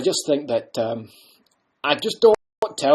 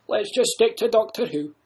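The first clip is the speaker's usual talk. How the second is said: loud laughing mock-mystery; the Doctor laughing off his name; teasing laugh; 6 semitones higher, 9 dB louder than usual. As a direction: tease them with a laugh. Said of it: "what's your second name".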